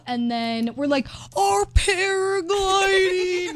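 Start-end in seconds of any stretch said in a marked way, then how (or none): 1.4-3.5 high, drawn-out slow-motion voice. Retold or imitated. Lost friends acting out themselves in slow motion. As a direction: stretch every syllable like slowed-down film. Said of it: "like, the slow motion".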